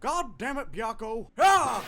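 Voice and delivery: loud, high angry yell ending in a cry; frustrated shout. Acted. Ice dragon shouting at Byakko who denies he's a dragon, angry yell. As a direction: blow up at Byakko.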